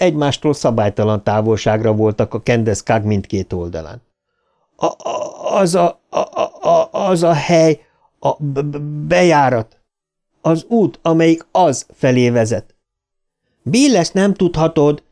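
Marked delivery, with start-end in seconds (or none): 4.8-12.7 stammering. A narrator's reading. Bill stammers explaining the entrance. 13.6-15.0 a raised, protesting call; shout. A narrator's reading. Beverly shouts at Bill, protesting. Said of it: "kiáltotta Beverly".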